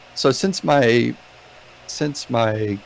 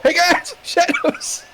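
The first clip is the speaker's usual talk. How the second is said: high shout across a hall; yelling across the room; slower than usual. Acted. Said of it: "I'm gonna shout. Can I shout across the hall?".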